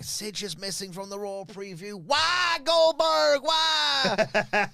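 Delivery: high, loud mock outrage; exasperated fan voice. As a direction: whine it like an indignant fan. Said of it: "if you're thinking".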